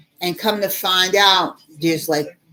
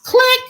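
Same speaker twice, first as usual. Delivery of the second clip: mock phone click; 5 dB louder than usual. Operator hangs up.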